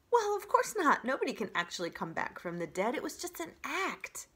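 high, fast showy voice; theatrical opossum. Acted. Opossum boasting to the robot, high and fast. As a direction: explain like a proud performer.

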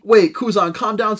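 calm a dangerous man fast; placating captain. Acted. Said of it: "says Blackbeard".

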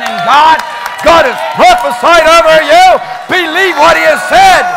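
loud, slow, shouted declaration; preaching at full force. Real, not acted. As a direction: thunder it over the congregation.

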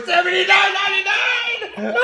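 high, loud squeal into laughter; shrieked excitement. Real, not acted. Parent announcing speaker price, shrieking with glee. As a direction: shout the price, then crack up.

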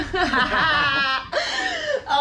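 loud, high laugh; uncontrolled high cackling laugh; real burst of laughter. Real, not acted. Laughs after demanding tears.

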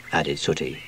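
plain reading voice

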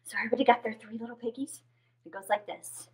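fast hushed whisper. Real, not acted. Teacher whispers to the children.

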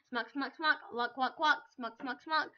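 loud chanted smack and whack; mock smack sounds. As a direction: chant the hits like a sound effect. Imitating game hit sounds.

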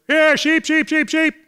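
high sing-song call to distant sheep; imitating another student calling, pitched high. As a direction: coax the flock down the hill. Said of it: "he called there".

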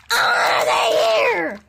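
slow, high panicked shout; panicked cry. Pretend. Toy train fearing the buses, panicked shout. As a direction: cry out in alarm as the buses come.

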